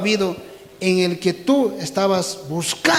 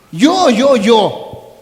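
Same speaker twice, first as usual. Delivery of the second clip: higher, much louder boastful me-me-me; mock bragging; 4 semitones higher, 8 dB louder, much slower than usual. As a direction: thump your chest, hammed-up. Mimicking boastful self-praise. (presumido exagerado y fuerte)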